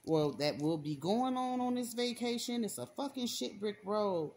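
normal talking voice

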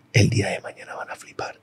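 whispered to himself, no voice at all; inner whisper. Retold or imitated. Teen tells himself inside while refusing help, whispering. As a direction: breathe it like a secret promise. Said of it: "y por dentro era".

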